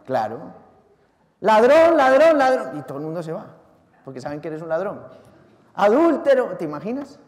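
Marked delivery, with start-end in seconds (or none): 1.4-2.8 high, much louder repeated cry; mock shout. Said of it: "ir gritando por la calle". 5.8-6.6 higher, louder single cry; mock shout. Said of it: "ir gritando por la calle".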